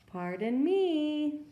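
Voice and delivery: a timid bear trying to be heard; lightly voiced shy bear; polite bear voice. Bear asks animals politely for help.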